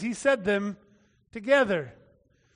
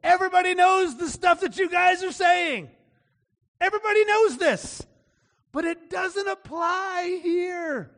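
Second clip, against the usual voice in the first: high-pitched, drawn-out exasperated protest; exasperated retort; 7 semitones higher, slower than usual. Acted. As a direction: throw it back at know-it-all friends. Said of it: "just throws that out there on him".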